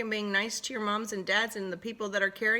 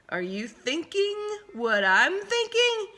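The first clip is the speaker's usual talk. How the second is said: much higher scheming question; sly plotting; 12 semitones higher than usual. Acted. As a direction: lift it high with mischief. Wolves plotting.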